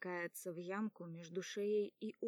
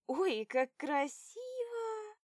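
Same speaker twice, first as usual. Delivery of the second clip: much higher, louder, slow squeal of joy; drunk delight; 12 semitones higher, 7 dB louder, slower than usual. A narrator's reading. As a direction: squeal at the lights. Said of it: "кричит Соня".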